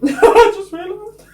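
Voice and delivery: laughing as it dawns on her, high and slow. Real, not acted. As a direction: laugh through the realisation. Laughing at own realisation.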